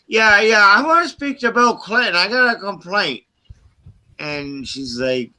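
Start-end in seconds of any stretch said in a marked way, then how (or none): 0.1-3.2 mock prank caller. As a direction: ham up the prank caller demanding Clinton.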